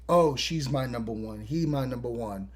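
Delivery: low, soft imitation; offhand mimicry. Characters each claim a number one, low offhand mock quote. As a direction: toss it off low and under the voice.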